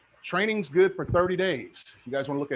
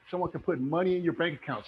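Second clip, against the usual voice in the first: ordinary delivery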